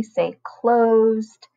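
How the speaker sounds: closed syllable, stretched slowly and clearly; slow model for class. Shown as a demonstration. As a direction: model it slowly so the kids can repeat. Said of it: "Can you say that with me?".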